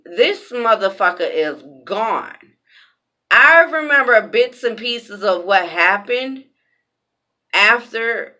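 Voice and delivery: usual speaking voice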